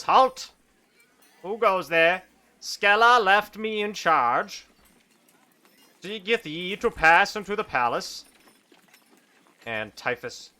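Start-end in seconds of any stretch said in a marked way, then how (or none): 0.0-8.3 higher, louder pompous guard; kobold guard. Acted. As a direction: bark the challenge. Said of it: "this kobold says".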